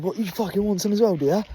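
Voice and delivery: playful pub brawler taunt; mock threat. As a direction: square up to the cows. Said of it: "yeah, I said".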